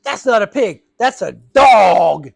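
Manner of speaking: loud, very slow drawn-out yell on dog; shouted correction. Retold or imitated. Lady shouting.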